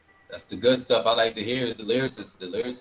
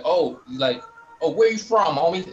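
a light West Coast greeting, pitched up and louder; lightly imitating a West Coast homie; a hint of West Coast accent; 6 semitones higher, 4 dB louder than usual. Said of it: "that accent".

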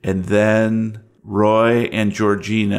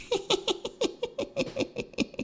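high breathless giggle; eerie unvoiced tittering; dead woman tittering on stairs; ghostly titter; 12 dB quieter than usual. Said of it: "She is tittering".

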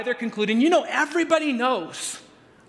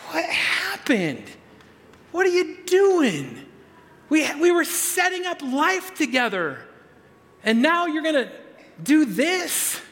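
higher, slow, pained questioning as Joseph; a betrayed man asking what happened; Joseph's frustrated voice; 6 semitones higher, slower than usual. Retold or imitated. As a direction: plead like a hurt fiancé. Joseph confronting Mary, voiced.

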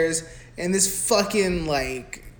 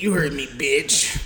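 menacing stepdad; 2 semitones lower than usual. Retold or imitated. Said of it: "He's like".

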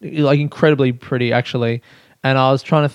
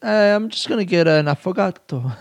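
laughing, higher mock-pretentious ordering voice; silly pretentious order; 5 semitones higher than usual. Shown as a demonstration. Podcaster demonstrating an ice cream order, silly and barely straight-faced. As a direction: order affogato grandly, about to crack up. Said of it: "You just gotta say it kinda silly".

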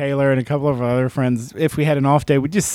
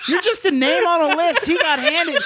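high, laughing while talking; 12 semitones higher than usual. Real, not acted. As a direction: giggle through the words. Talks through laughter.

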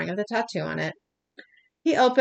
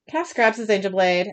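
ordinary delivery

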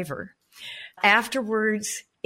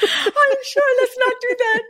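laughing; 6 dB louder than usual. Real, not acted. Joking about buying a husband.

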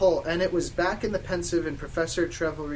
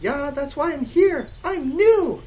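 high, loud and slow, gushing; happy smile; 10 semitones higher, 8 dB louder, slower than usual. Acted. New girl telling Satan about herself, happy. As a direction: beam through it, pitched way up. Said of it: "I smiled happily".

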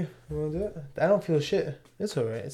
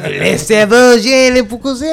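Quebec French accent, a bit higher; Mexican Quebec accent; 6 semitones higher, 5 dB louder than usual. Shown as a demonstration. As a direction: put on a jokey Quebec accent. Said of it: "that Mexican Quebec accent".

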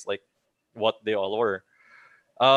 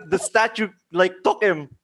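ordinary delivery

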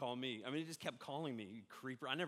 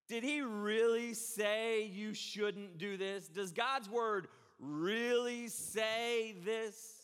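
sly serpent; 5 semitones higher, 4 dB louder, much slower than usual. Acted. Satan's question voiced again.

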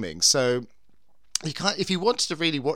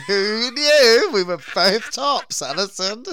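laughing; 6 semitones higher, 6 dB louder, much slower than usual. Real, not acted. Laughing joke.

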